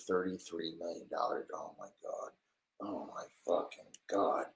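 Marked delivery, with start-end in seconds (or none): 1.5-4.5 softer unvoiced whisper; whispered shock at the trade news; stunned whisper. Real, not acted.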